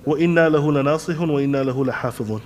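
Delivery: rattled-off Arabic verses, fast; تلاوة سريعة جدا; rapid recitation. Shown as a demonstration. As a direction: fire the verses off quickly.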